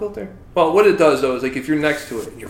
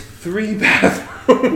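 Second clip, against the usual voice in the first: laughing; 6 semitones higher, 5 dB louder, much slower than usual. Acted. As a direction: stress on "three" through a laugh. Repeats with laugh, stressing three.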